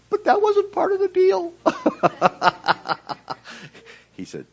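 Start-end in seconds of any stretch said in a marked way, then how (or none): 0.1-1.5 much higher, loud mock whine; comic protest. 1.6-3.9 real laughing; laughter. Real, not acted. Laughs at own joke.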